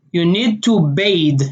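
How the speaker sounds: "bathe" said as bayd, slow; Israeli bathe with D. Shown as a demonstration. Showing how Israelis say bathe, ending in D. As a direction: end "bathe" on a hard D. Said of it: "they would say".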